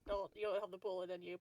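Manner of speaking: ordinary talk, nothing marked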